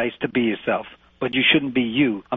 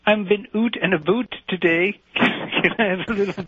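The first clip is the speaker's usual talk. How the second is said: mocking Canadian accent; 7 semitones higher than usual. Coach imitating his student in a coffee shop, mocking Canadian accent. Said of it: "I don't sound like that".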